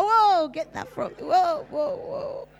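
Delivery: high, loud, laughing "whoa" backing away; shy away from the chicken, laughing; laughing recoil. Retold or imitated. Preacher recoiling from a gift chicken, loud and laughing. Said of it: "I'm like".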